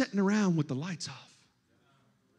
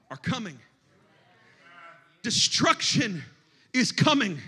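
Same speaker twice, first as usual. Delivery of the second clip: ordinary talk, nothing marked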